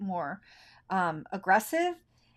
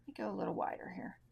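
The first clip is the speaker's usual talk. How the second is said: soft fast whisper to herself; hushed working murmur; whispered aside; 12 dB quieter, much faster than usual. Real, not acted. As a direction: breathe it out quickly under your breath. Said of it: "unvoiced whisper".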